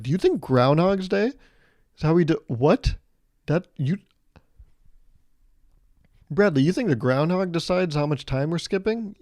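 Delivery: can't believe what he's hearing; incredulous coworker. Retold or imitated. Coworker baffled by manager's belief.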